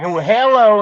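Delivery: high hillbilly phone greeting; put-on American hillbilly drawl. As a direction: answer like a hammed-up hillbilly. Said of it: "I played the regular American hillbilly".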